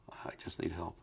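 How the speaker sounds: 힘없이 속삭이는 목소리; weak whisper. Retold or imitated. Desperate man asking for help.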